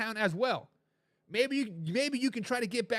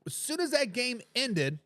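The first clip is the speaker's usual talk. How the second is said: ordinary talk, nothing marked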